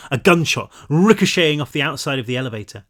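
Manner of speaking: plain reading voice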